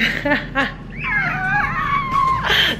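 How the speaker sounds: high nervous laugh breaking into a cry; real nervous laughing cry; laugh-cry squeal. Real, not acted. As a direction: squeal out a half laugh, half sob. Nervous about surgery tomorrow.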